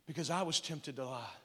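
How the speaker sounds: low, soft intercession to the Father; Jesus. Acted. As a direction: plead quietly for them, as in private prayer. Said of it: "He says, "Father".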